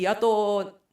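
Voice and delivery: usual speaking voice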